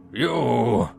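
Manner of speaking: put-on rough Scottish accent, roared. A narrator's reading. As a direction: drunk Scot bellowing. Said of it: "he roared again".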